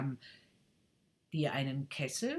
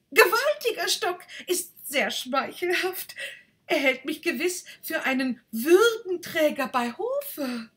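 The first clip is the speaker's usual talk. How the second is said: loud, higher and pleased; flattered rocket; 13 semitones higher, 9 dB louder than usual. Acted. Rocket takes the boy's joke as flattery, loud and higher. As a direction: preen at the praise.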